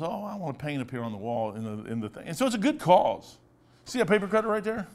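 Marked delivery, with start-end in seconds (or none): 0.0-2.2 quick, soft daughter's request, lightly voiced; imitating daughter. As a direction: rattle off the daughter's long ask quietly and fast. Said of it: "She goes".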